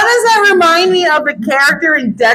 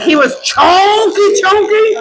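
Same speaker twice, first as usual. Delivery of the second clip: incredulous rise; 7 semitones higher, slower than usual. Real, not acted. Asking how fat.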